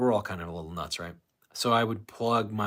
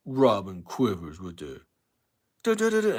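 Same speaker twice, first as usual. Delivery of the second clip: Hate Man impression; 6 dB louder than usual. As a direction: ham up Hate Man's rant. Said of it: "I can do a Hate Man impression".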